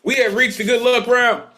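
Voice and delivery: high, loud MC announcement; announcer call. Real, not acted. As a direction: call out the round to the whole room. Host calls out next round.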